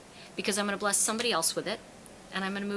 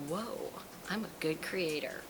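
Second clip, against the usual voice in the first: soft, slow wondering voice; hushed, acted self-discovery; quiet awe; 3 semitones lower, 9 dB quieter than usual. Voicing a self-realization.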